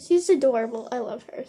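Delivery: normal talking voice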